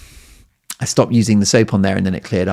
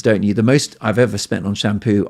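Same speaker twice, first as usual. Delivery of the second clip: ordinary talk, nothing marked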